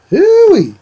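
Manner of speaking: a quick, very high "hooey" hoot. Shown as a demonstration. Answering the viewer's request with a playful hoot. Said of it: "give me a hoot and a holler".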